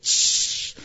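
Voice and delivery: loud burst of hiss; imitated freeze spray.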